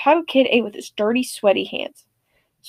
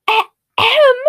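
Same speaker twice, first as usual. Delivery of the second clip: exaggerated ahem; 12 semitones higher, 6 dB louder than usual. Exaggerated throat clear.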